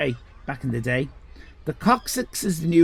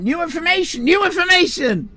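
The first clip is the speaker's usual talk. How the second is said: higher, louder mock announcement; mock fanfare; 11 semitones higher, 4 dB louder than usual. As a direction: announce it like breaking news. Mock announcing new info.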